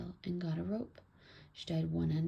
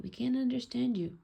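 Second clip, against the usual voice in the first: calling down; 6 semitones higher, 3 dB louder than usual. A narrator's reading. Child calls to buried father.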